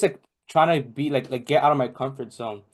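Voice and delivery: ordinary delivery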